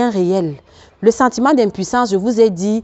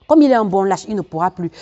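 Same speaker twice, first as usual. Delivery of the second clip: ordinary talk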